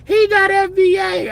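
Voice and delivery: high mocking whine; mocking accuser voice. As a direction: squeak it out like a whiny accuser. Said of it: "Could you imagine somebody".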